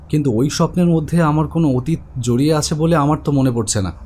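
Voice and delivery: ordinary talk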